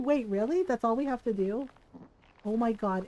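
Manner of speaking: ordinary delivery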